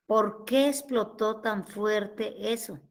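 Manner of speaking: slow, low, measured question; retold pondering. Wife quoting her husband wondering about the explosion, pondering. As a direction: puzzle it out word by word, softly.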